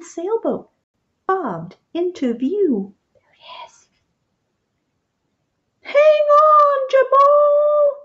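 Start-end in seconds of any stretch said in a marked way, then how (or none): 3.1-3.9 whisper. A narrator's reading. Spotting frog from boat. 5.8-8.0 high, loud urgent shout; anxious call. A narrator's reading. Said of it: "an anxious voice called".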